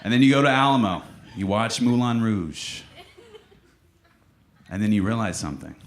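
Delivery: ordinary talk, nothing marked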